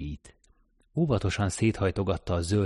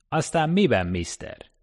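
gruff cabbie; 8 semitones higher, 4 dB louder than usual. Acted. Taxi driver answers stranger.

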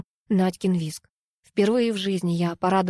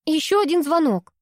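called out; 8 semitones higher than usual. A narrator's reading. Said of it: "выкрикнула я".

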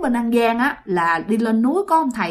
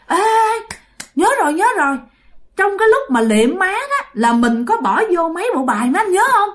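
sudden realization; 8 semitones higher than usual. Acted. Wife suddenly remembers.

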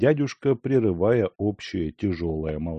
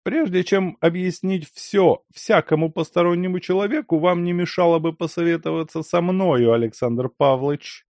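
Lyakhovsky voice; 10 semitones higher, 3 dB louder than usual. A narrator's reading. Lyakhovsky's voice, high and strained.

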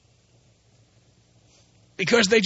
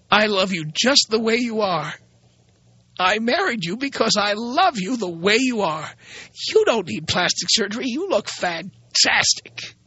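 ordinary talk

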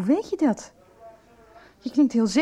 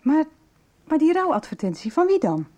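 higher and louder, pressing for answers; urgent disbelief; 6 semitones higher, 4 dB louder than usual. Acted. Friend stunned by Joris's news, demanding answers. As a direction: push the question out, rattled.

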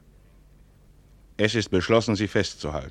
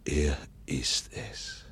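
slow hushed whisper, watching; tense whisper; 3 semitones lower, 9 dB quieter, slower than usual. Acted. Watching his enemy arrive, a tense whisper.

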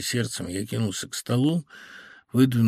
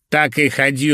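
loud, higher, chopped-off dismissal; brusque dismissal; 4 semitones higher, 6 dB louder than usual. A narrator's reading. Phil dismissing Irina as she leaves, brusque. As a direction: cut her off forcefully. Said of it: "рубанув ладошкой".

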